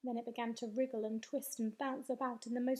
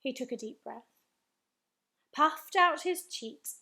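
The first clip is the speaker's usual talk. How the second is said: ordinary delivery, nothing marked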